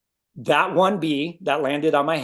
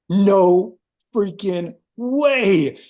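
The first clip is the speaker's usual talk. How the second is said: amazed; 5 semitones higher, 5 dB louder, much slower than usual. Retold or imitated. Amazed by nature's acupuncture.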